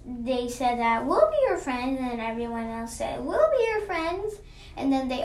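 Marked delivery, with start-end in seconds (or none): 1.1-2.0 higher, bright-pitched offer; high, eager group reply; friendly kids. Acted. Kids console the alien. 3.2-4.4 high, singsong offer; lifted voice of a friendly group; everyone chiming in. Acted.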